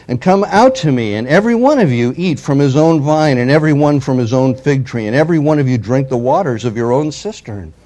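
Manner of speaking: loud, declaiming the envoy's taunt; forceful scripture reading. Acted. Assyrian envoy taunting Jerusalem, read out loud and forceful. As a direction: proclaim it like a herald's challenge.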